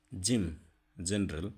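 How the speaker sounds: strong j for জেনারেল; hard j sound. Shown as a demonstration. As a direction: punch the j in জিম. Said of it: "উচ্চারণ শক্ত হবে".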